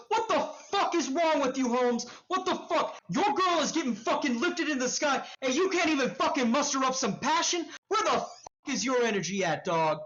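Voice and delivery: high, fast, heated street-tough rant; angry tirade. Acted. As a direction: berate them in rapid, incredulous bursts. Stranger berates player after punch.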